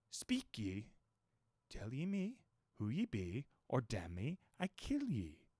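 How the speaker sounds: slow, soft menacing growl in broken English; growling cannibal. A narrator's reading. Queequeg growls threats at the narrator, soft menace. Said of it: "growled the cannibal".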